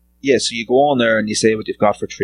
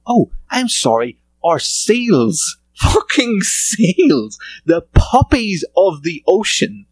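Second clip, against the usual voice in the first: high, drawn-out sarcastic disbelief; mock outrage; 8 semitones higher, slower than usual. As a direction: act scandalised on behalf of the seals. Mock outrage at insulting seals.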